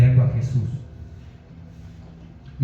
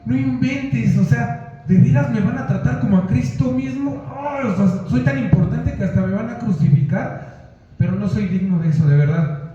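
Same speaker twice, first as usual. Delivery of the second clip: much higher, gushing mock-giddy voice; giddy Peter; 6 semitones higher than usual. Apostle Peter thrilled to be crucified, higher gushing voice. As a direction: camp it up like a thrilled gossip. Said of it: "se puso contento y dijo".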